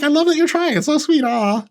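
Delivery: high, sugary mock praise that looks down on her; condescending coo. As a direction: coo it like praising a toddler's drawing. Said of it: "And I'm like".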